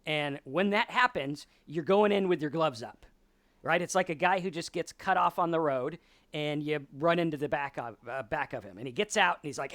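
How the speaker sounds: ordinary talk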